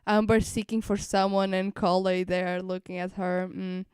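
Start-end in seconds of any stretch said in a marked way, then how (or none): none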